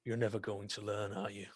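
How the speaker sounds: the captain holds Dag up and quietly rebukes him; quiet weary threat. Acted. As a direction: talk down to him, barely above a murmur. Captain lifts Dag by cloak.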